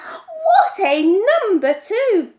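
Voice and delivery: high, loud, very slow; boy marvelling, high, loud, slow; awed exclaim. A narrator's reading. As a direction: savour every word.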